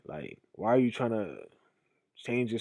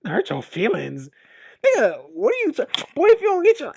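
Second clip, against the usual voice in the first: much higher, louder mocking yell at a friend; mocking roast; 12 semitones higher, 9 dB louder than usual. Friend roasting a guy who admits hurt feelings, mocking shout. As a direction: scoff and roast him, pitch shooting up. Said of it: "you be like".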